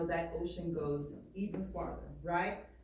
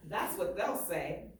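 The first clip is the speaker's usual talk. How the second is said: lively read-aloud, painting each picture; story reading; 4 semitones higher than usual. Acted. Teacher reading a barbershop story aloud to students, lively. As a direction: read it to the class with swagger and pride. Reads the book aloud to class.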